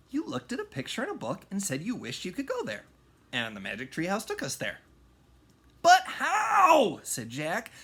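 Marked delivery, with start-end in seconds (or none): 0.1-4.8 quick explanation. A narrator's reading. 5.8-7.0 high, loud astonished question; amazed. A narrator's reading. Jack's amazement.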